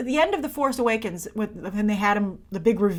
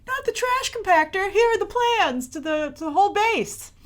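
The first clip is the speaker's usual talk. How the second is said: mock panicked Phasma; 10 semitones higher than usual. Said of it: "But instead she was like".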